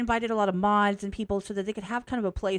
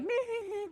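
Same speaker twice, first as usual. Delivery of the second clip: silly laugh; 14 semitones higher, 5 dB quieter than usual. Retold or imitated. Mock laugh with daughter.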